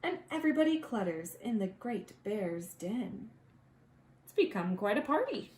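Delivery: usual speaking voice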